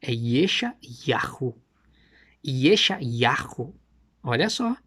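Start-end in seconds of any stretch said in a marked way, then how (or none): 0.0-3.8 Yeshayahu very slow, syllables drawn out; Hebrew pronunciation. Shown as a demonstration. Answering how Isaiah sounds in the original, very slowly. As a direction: intone Yeshayahu slowly, like a chant. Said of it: "Como é que é no original?".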